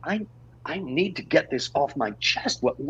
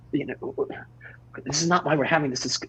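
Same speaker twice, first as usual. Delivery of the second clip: ordinary delivery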